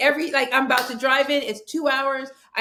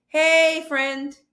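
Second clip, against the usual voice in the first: mock cheerful greeting; 6 semitones higher, 6 dB louder than usual. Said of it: "I send you the text".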